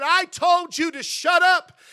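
slow, loud and high threat; angry warning. Retold or imitated. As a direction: lean on every word to shut him down. Father repeats order to stop.

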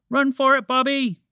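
high, loud warning cry; urgent call. A narrator's reading. Moose warns creature of creeping chocolate, urgent high shout. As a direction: shout a warning across to a friend. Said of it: "Montgomery the Moose called".

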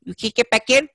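very high, loud mock disbelief; exaggerated impersonation, squawked up high in gleeful disbelief; Joe Pesci. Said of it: "And Pesci goes".

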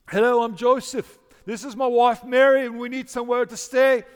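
put-on loud, high-pitched stagey British accent. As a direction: project a hammy British accent that drifts towards Irish. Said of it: "in a British voice, English accent".

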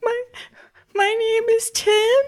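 put-on very high, hesitant small-kid voice; timid little child. Child giving name to the rescuer, timid child voice. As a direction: answer like a shy, shaken little child. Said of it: "What was your name again?".

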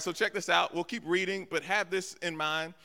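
ordinary delivery

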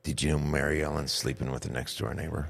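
low, hushed scandalous gossip; malicious gossip. Retold or imitated. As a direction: whisper the scandal slyly. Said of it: "a malicious gossip is the one that says".

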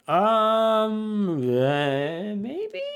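hesitant hum, then a high squeaky maybe; stall, then squeak out the maybe; unsure professor. Retold or imitated. Professor dodging the boy's question, hesitant squeak. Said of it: "And Ruffleberg's like".

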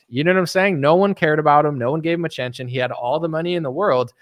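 usual speaking voice

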